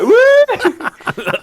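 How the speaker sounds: loud, very high mock "woo"; high-pitched whoop. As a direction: squeal a retold whoop through laughter. Retold astonished reaction to dad's boast.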